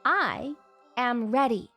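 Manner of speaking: ordinary talk, nothing marked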